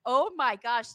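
ordinary talk, nothing marked

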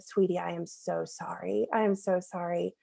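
put-on soft, hushed sorry to a little boy; gently, quietly, like comforting a crying child; tender apology. Mom telling her son she's sorry, softly tender.

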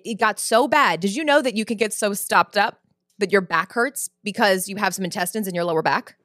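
usual speaking voice

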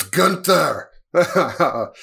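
mock-foreign wrestling-villain announcement of GUNTHER; foreign heel accent. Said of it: "sounded more, like, foreign and heelish".

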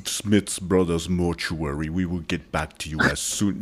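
very serious voicemail greeting. Retold or imitated. Co-host teasing a friend about his voicemail, deep and deadly serious impression. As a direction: intone it deep and solemn, like a funeral-home recording. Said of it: "you don't sound like your voicemail".

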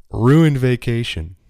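mock-mechanic delivering a joke, loud and drawn out; retold joke, punched out big; mechanic's punchline. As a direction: land the corny punchline slowly and loudly.

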